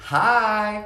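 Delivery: high, drawn-out friendly hi; cheery greeting. Retold or imitated. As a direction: imitate a mom's eager, welcoming hello. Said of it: "comes around the corner super quickly saying".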